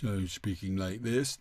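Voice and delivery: overdone chest resonance; mock booming chest-voice demo; ludicrous chest voice. Shown as a demonstration. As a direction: push the voice absurdly into the chest. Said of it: "I'm not talking about making this ludicrous".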